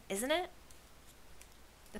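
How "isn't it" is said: high, rising, eager check; excited question. A narrator's reading. Torrin checking his guess with friends, excited. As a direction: ask eagerly, pitched up. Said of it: "cried Torrin".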